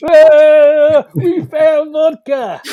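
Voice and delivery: put-on very slow, high, loud drunken slur. Drunk friends telling Ronnie they found vodka, slurring. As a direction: drag the words out like a tipsy reveller.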